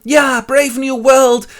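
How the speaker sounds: high, loud, drawn-out cheer; thrilled cheer. Acted. John is invited to civilization, excited high cheer. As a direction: cheer it slowly at the top of your voice.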